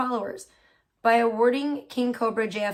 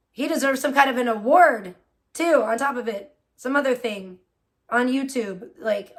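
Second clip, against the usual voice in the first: ordinary delivery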